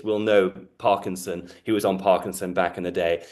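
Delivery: usual speaking voice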